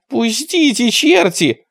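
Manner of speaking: high, wailing protest; tearful voice. A narrator's reading. Captured boy begging to be let go, tearful. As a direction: plead tearfully in a high pitch. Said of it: "раздался чей-то плачущий голос".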